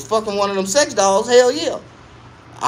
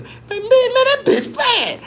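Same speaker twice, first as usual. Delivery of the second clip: put-on amazed old homie; 3 dB louder, slower than usual. OG amazed at the doll.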